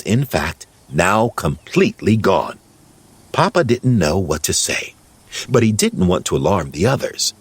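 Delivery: ordinary delivery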